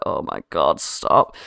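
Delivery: very low, weary mutter; exasperated. Acted. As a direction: groan it low under your breath. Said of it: "just holding his forehead like".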